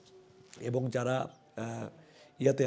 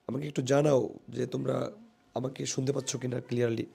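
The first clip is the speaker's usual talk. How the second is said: ordinary delivery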